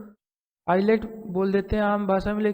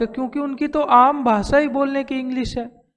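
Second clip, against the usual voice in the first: normal talking voice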